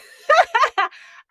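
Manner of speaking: high burst of laughter at herself; real laugh of surprise; high delighted laugh. Real, not acted. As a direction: laugh out loud, amazed at yourself. Laughs at her own realization.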